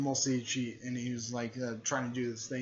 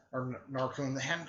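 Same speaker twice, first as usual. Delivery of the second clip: ordinary delivery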